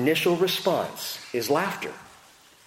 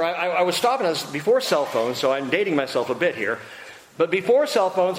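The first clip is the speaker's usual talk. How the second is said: ordinary delivery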